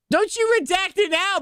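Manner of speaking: high, teasing scold; hammed-up scolding. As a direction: wag a finger, half-laughing. Repeats scold, higher.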